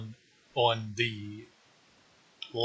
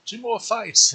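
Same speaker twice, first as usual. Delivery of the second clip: excited; 11 semitones higher, 5 dB louder than usual. Real, not acted. Excited about evolution soon.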